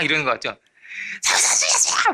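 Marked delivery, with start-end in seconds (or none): 1.2-2.1 very high, strained mock scream; acted desperate scream. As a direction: scream for help in a thin, airy voice. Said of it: "더 크게 소리". (아주 높고 쉰 소리로 살려달라는 비명 연기)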